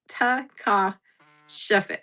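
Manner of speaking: taka-shafe drawn out very slowly and softly; slow sounding out. Shown as a demonstration. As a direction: stretch taka-shafe out syllable by syllable.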